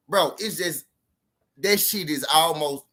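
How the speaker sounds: normal talking voice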